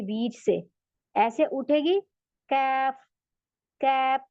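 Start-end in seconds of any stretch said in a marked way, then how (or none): 2.5-4.2 कैफ light, from the middle of the palate; कैफ said thin and front; thin kaf. Shown as a demonstration. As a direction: keep the k fine and light. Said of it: "इस वजह से ये बारीक हो जाता है".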